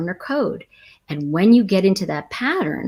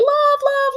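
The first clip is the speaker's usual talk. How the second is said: love swooped far higher; gushing love. Real, not acted. About London theater, gushing on love. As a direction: stretch love out in delight.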